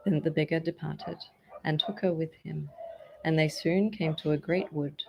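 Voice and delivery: ordinary delivery, nothing marked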